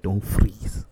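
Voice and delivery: loud clipped order, put-on voice; movie warrior command. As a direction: bark the order like a warrior general. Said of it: "She's like".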